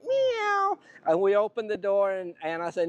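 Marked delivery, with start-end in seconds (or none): 0.1-0.8 kitten meow. Acted. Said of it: "almost knocking".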